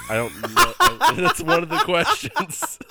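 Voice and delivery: talking through loud, high laughter; cracking up, squeal the words out; real laughter. Real, not acted. Cracking up at their own silly question, laughing.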